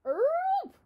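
high, loud yelping wail; dog wail. Acted. Dog wailing back at the preacher, high loud wail. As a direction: let out a sharp dog's wail. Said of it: "wailed Winn-Dixie".

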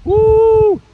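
high, loud joyful whoop; excited whoop. Real, not acted. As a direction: let out a big gym whoop. Coach whoops during exercise.

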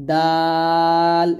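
দাল with a light d and very long a; tongue tip behind upper teeth, stretch the vowel of দাল; thin Arabic dal. Shown as a demonstration. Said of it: "এরকম পাতলা করে". (দাল পাতলা করে লম্বা টানে)

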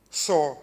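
со hit hard and loud on its own; single syllable со, shouted for emphasis; stress on со. Shown as a demonstration. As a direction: punch the prefix со. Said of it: "наголос не на слова, а от на".

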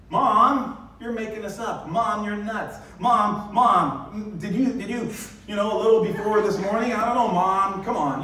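a grown son brushing off his mother; exasperated son. Pretend. James telling his mother her story is nuts, exasperated and loud. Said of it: "One of the disciples is James".

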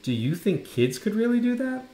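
put-on lightly imploring child's question; begging. A narrator's reading. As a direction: ask it high, wanting reassurance. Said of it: "I begged her".

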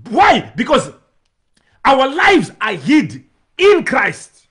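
loud, climbing pulpit preaching; preacher crying out that Jesus preserves us; full-force preaching. Acted. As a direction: drive the point home to the congregation.

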